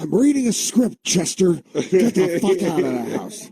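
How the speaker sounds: high, exasperated father rant; ranting Tom Hanks. Retold or imitated. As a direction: snap at the son like a fed-up dad. Tom Hanks yelling at son.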